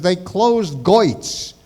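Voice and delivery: slow, broad Brooklynese; Brooklyn accent. Retold or imitated. Uncle breaking news of store closing, Brooklyn accent. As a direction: drag out the OY in Brooklyn style. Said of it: "He spoke with a Brooklyn accent".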